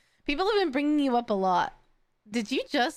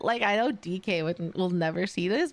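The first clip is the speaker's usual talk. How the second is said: ordinary talk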